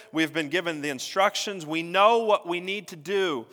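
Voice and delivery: rising, forceful pulpit preaching. Acted. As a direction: preach it out to the congregation. Preaching the climax of the point.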